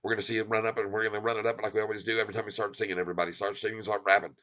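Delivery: quick, low-key rolling patter; auctioneer fast talk. Shown as a demonstration. Streamer showing viewers his fun fast talk, auctioneer fast talk. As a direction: show off a playful auction rattle. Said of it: "We're gonna be talking like this".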